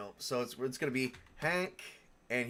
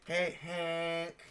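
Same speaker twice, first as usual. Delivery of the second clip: higher, nasal-free needling hey Hank; annoying voice; 5 semitones higher than usual. Shown as a demonstration. Teasing the new character Hank, in an annoying voice. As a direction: drag out the hey to sound irritating. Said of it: "say it in an annoying voice".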